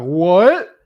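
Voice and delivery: incredulous high, loud what; mock surprise. Imitating people hearing someone with an accent, mock surprise. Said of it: "they're like".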